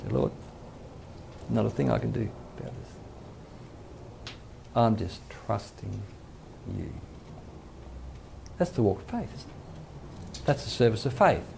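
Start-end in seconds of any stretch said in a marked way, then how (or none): 0.1-6.9 slow, soft, near-whispered surrender; hushed prayer. Retold or imitated. Believer surrendering to the Lord, whispering slowly. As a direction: breathe it to God, slow and hushed.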